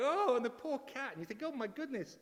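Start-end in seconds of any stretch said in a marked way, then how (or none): 0.0-0.4 high loud crowd gasp; loud high mock crowd oh. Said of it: "the crowd are going". 1.4-2.2 high, alarmed oh my goodness; mock alarm, much higher; anxious gasp. Anxious for falling cat.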